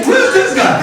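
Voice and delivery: very high-pitched mock outrage; offended relative. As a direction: demand it in hammed-up indignation. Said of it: "The guys are now offended".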